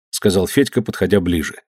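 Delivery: ordinary delivery, nothing marked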